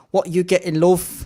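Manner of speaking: a mock Liverpool counter girl, thick Scouse; Scouse accent. Retelling a Liverpool KFC queue, mock Scouse accent. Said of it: "could not get more Scouse than that".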